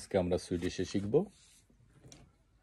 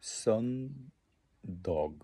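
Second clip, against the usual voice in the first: সন্দগ spoken very slowly, with a falling end; slow careful demo; 3 semitones higher, much slower than usual. Shown as a demonstration. Teacher to learners, stretching সন্দগ very slowly. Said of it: "দগ মানে হলো day".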